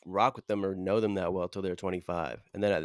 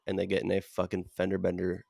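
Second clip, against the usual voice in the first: ordinary talk, nothing marked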